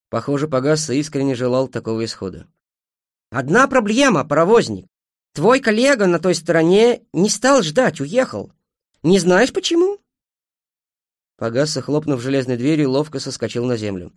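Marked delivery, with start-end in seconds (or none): 3.3-10.0 loud, raised challenge; confrontational. A narrator's reading. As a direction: press the driver for an answer. Confronting the engine driver. (с вызовом, громко и высоко)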